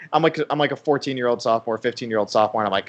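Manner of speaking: normal talking voice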